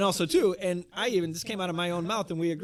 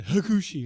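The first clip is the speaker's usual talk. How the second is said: mock sneeze turned into a name, pitched up; fake sneeze; 4 semitones higher, 4 dB louder than usual. Wrestler sneezing a rival's name mid-speech, fake sneeze. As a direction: burst into the word like a sneeze. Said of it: "and then he sneezes again".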